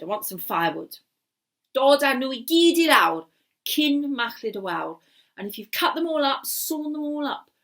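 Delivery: the wizard toying with his captive; scheming wizard. Acted. Wizard taunting captive Gareth, sly and loud.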